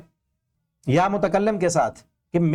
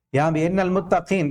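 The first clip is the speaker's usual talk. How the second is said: ordinary delivery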